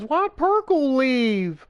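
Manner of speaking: lightly voiced coworker, dragging it out; imitating her again. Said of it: "She goes".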